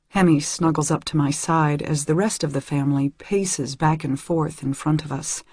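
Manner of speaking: ordinary delivery, nothing marked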